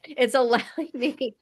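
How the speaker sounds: laugh-cry through the words; laughing through tears. Real, not acted. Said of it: "can't stop crying".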